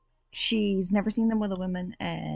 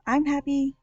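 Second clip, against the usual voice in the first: higher, bright-pitched show of cheer; cheery voice; 9 semitones higher, 3 dB louder than usual. Shown as a demonstration. Vlogger greeting viewers in a high cheery voice. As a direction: put on a chipper voice to open the video. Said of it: "My voice is cheery".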